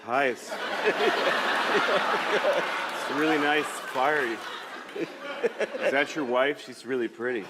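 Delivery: slow, halting mock creep voice; creepy lone stranger. Lone man stepping from the woods to campers, acting creepy. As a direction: draw it out like an awkward lurker. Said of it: "If I'm alone, I can't just come out of the woods".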